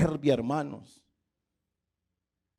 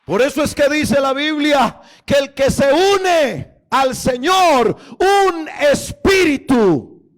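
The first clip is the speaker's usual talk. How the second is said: loud, high, chanting the repeated aquí es; fiery preaching; 9 semitones higher, 8 dB louder than usual. Real, not acted. Pastor crying out to the church about grace. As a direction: preach it full force like a revival.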